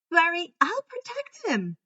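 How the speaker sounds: high, cheerful and bright; grinning. A narrator's reading. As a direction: chirp it with a grin. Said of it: "with a grin at the herd".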